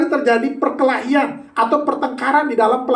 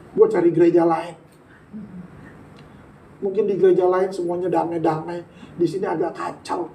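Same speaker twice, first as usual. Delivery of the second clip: low, softer grumbling to God; lightly voiced grumbling member; mock offended complaint; 5 semitones lower, 6 dB quieter than usual. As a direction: play a hurt churchgoer ready to quit.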